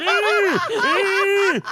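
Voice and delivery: high squealing wheeze of laughter; imitating dad's breathless wheeze; wheezing laugh. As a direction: wheeze like you can't breathe.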